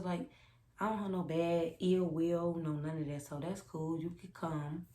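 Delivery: usual speaking voice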